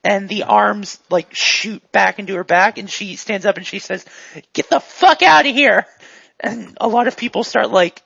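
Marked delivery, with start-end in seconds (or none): 4.5-5.9 loud, high-pitched order to get out; angry shout. Acted. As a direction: bark it at a crowd of customers. Shop owner orders customers out.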